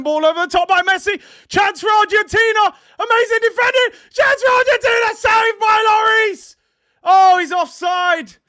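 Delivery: high, loud, urgent play-by-play yelling; excited shouted commentary. Real, not acted. Streamer calling a late chance to his live chat, shouting and excited. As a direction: call the chance like it's the goal of the century.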